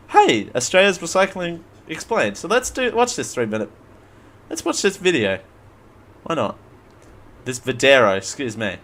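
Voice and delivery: ordinary talk, nothing marked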